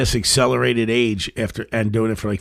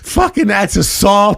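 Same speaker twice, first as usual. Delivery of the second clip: mock outrage; 7 semitones higher, slower than usual. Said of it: "people who were like".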